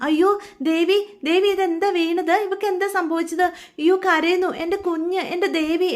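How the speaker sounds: panicked husband crying out over his fallen wife; distressed cry. A narrator's reading. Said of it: "Anand ആകെ സങ്കടപ്പെട്ട്".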